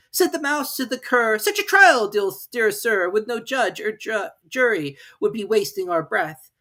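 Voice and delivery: high, slow, pleading; pleading mouse. Acted. Mouse pleading with the dog, high and loud. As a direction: beg politely.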